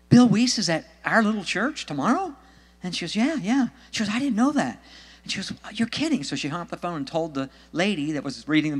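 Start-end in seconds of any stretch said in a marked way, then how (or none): 0.1-2.3 high, loud incredulous question; astonished. Retold or imitated. Woman hearing the author visits her church, astonished. Astonished friend hears the news. 3.1-3.7 high, eager retold reply; excited confirmation. Friend confirming the news on the phone, excited. 4.1-6.2 high-pitched retold disbelief; amazed. Woman on the phone learning of tomorrow's speaker, amazed.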